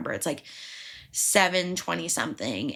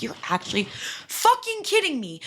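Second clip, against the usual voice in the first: exasperated disbelief; 7 semitones higher than usual. Retold or imitated. Ticket won't load, panicking.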